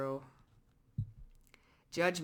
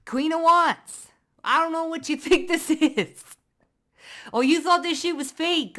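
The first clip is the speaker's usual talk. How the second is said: triumphant; 9 semitones higher, 8 dB louder than usual. Real, not acted. Reader gloating at doubters as card confirms her. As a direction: crow over the card.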